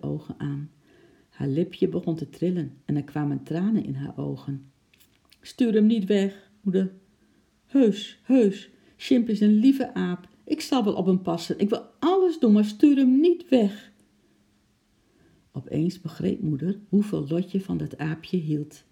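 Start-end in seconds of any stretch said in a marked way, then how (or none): 5.4-13.9 pleading. Acted. Child begging mother to keep pet.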